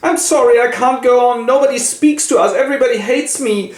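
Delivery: high, pleading and close to tears; upset Denver. Acted. Denver pours out her misery to her mother, high and pleading. Narrator voices Denver's outburst.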